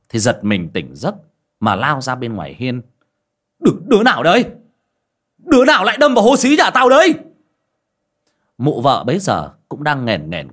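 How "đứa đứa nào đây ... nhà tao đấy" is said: high, loud, fast shouting; roused from bed and hollering; angry yell. Acted. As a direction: bellow at the unseen culprit. Woken neighbour yelling into night.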